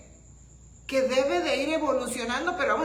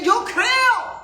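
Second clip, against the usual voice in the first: loud, much higher cry of faith; shout; 9 semitones higher, 8 dB louder than usual. Shown as a demonstration. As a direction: shout it out as an example to the congregation.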